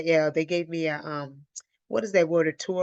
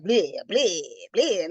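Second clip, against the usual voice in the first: mock gagging; much slower than usual. Morning sickness gagging imitation.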